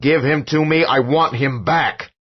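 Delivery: high, loud, slow desperate cry; desperate yell. A narrator's reading. Father screaming at the possessed boy, desperate yell. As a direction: plead and demand at once.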